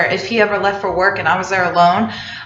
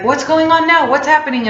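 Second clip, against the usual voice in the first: put-on higher, urgent boyfriend rushing in; a lightly raised, urgent mock of the boyfriend; alarmed boyfriend; 7 semitones higher than usual. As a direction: voice him running in worried. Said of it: "and he's like".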